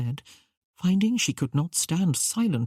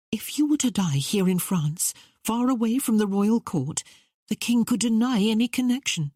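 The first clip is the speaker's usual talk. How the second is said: lightly voiced, higher-pitched young woman; earnest young woman; 6 semitones higher than usual. A narrator's reading. Young woman warning the queen of danger, earnest.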